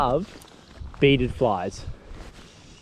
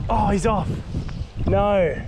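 dismayed cry; 7 semitones higher, slower than usual. Real, not acted. Fish comes off the hook.

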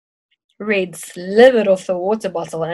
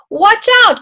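a sharp cry, much higher and louder; cried out; 14 semitones higher, 8 dB louder than usual. A narrator's reading. Teacher warning her arriving class, a high loud cry. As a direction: call out the warning with alarm. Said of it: "cried Missus Brewster".

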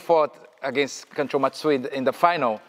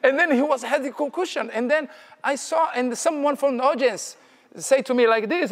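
high-pitched, animated retelling breaking into laughs; excited storytelling; 9 semitones higher than usual. Real, not acted. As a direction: get carried away by the story, voice riding high. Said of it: "I'm sorry, I got too excited".

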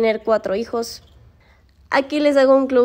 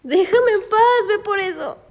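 exasperated plea; 8 semitones higher than usual. Real, not acted. Woman fending off her dog, exasperated plea.

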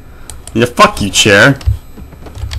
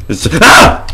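startled yelp; 5 semitones higher than usual. Real, not acted. Startled mid-sentence.

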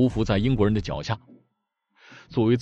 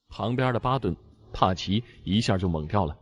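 ordinary delivery

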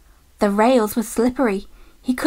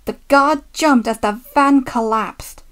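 usual speaking voice

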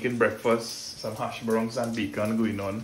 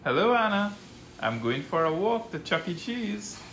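playful teasing; 7 semitones higher than usual. Pretend. Teasing hiding child.

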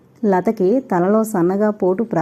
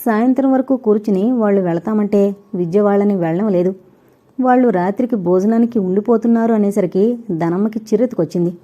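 ordinary delivery

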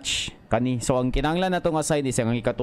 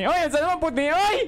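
scared yelp; 11 semitones higher than usual. Real, not acted. Panicked streamer in horror game.

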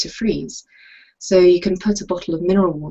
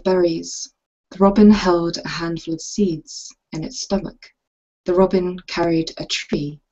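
measured poem recital with long pauses; poetry reading. Acted. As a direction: read it as verse, unhurried, pausing between phrases. Reads her poem aloud.

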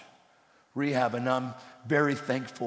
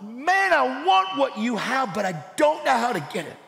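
pleading; 8 semitones higher, 4 dB louder than usual. Retold or imitated. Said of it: "And I went".

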